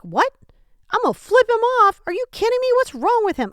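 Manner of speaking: high, loud outraged yelling; mock road rage. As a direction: blow up at the driver who cut you off. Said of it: "You'd be like".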